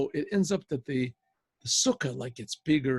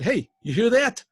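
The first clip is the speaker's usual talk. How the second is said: sharp call; 7 semitones higher, 7 dB louder than usual. Acted. KGB head quoted calling out.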